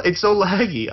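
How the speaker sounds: ordinary talk, nothing marked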